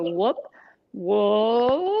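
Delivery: vocal exercise whoop; demo whoop. Shown as a demonstration. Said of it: "here we go".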